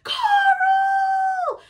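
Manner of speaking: high, loud desperate calling out; shrieking for someone out of reach; frantic shouted name. Retold or imitated. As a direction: scream the name like a panicked parent. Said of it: "I hear".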